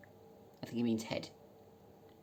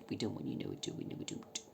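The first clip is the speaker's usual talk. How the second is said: whispered aside; 11 semitones lower, 10 dB quieter than usual. Acted. Teasing aside to camera.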